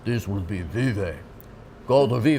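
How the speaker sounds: mock Russian accent; deliberate, heavy Russian-accented delivery. Shown as a demonstration. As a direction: put on a playful Russian voice. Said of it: "You could do a Russian accent".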